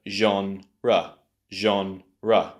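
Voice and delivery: genre slowly as ZHON... ruh; slow two-beat genre ending in schwa; syllables put together. Shown as a demonstration. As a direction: separate genre into French zhon and English ruh. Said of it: "Put it all together".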